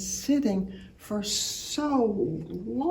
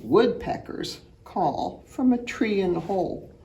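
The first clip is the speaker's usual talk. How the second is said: ordinary delivery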